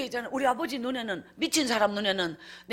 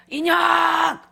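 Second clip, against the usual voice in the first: put-on high, loud drunken yell of her name; drunk bellow; 7 semitones higher, 10 dB louder, much slower than usual. Drunk father bellowing at his wife, a loud raging yell.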